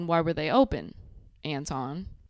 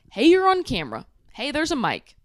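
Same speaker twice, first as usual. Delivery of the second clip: mock calling out; 6 semitones higher, 6 dB louder than usual.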